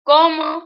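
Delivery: "Como" with a loud, high first syllable; "Como" said high and loud, weight on CO; stress on first O. Shown as a demonstration. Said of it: "with the accent above the first O".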